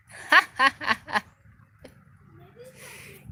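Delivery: loud, high burst of laughter. Real, not acted. Laughs about her crazy toddler.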